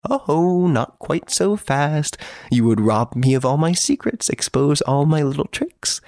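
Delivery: high, playful mock protest; teasing gentleman prisoner.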